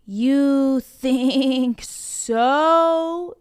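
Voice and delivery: very slow, menacing big goat. Acted. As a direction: drawl it as a challenge. Biggest goat challenges troll.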